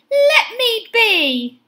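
high, loud, drawn-out plea; shout. A narrator's reading. Benny to the dog chasing him, slow high shout. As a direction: shout it slowly, stretching the words. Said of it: "shouted Benny".

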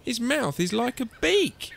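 high-pitched fussy complaint; fault-finding mother, voice rising; grumbling. A narrator's reading. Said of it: "And she grumbled".

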